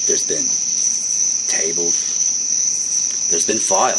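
slow, low, dramatic fight-night promo read; wrestling promo. Acted. Two hosts hyping a tag team match, low dramatic promo. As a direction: build up the match like a trailer voiceover.

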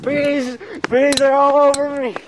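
very high, frantic yelps; panicked screaming. Acted. Friend swarmed by bees, high panicked yelling. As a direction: shriek for help while fleeing. Attacked by bees while running.

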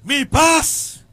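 slow, loud, weighty declaration; solemn proclamation. Acted. The Lord speaking to his son on the plane, slow solemn proclamation. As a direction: proclaim each word slowly like a divine voice.

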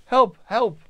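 loud, high cries into the dark; shouting for help. Acted. King alone in the dark village, shouting for help. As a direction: yell out for help at full volume. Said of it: "calling out for help".

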